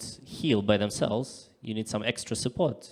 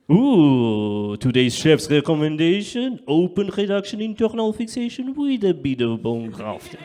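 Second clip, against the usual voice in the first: loud, higher, savouring fine-dining waiter; fancy waiter; 5 semitones higher, 7 dB louder than usual. Retold or imitated. Patient hears surgery as a French restaurant dish, savouring waiter. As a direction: present it like a chef's special. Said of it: "as I was in the French fine dining restaurant".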